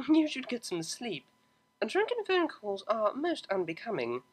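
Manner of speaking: high, soft, prim amusement; amused posh mare. A narrator's reading. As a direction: chide gently, amused. Octavia voiced, amused.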